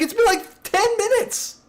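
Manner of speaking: very high, squeaky incredulous whine; incredulous coworker. Retold or imitated. Coworker protesting to the prankster, very high squeal. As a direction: squeal it like you can't believe it.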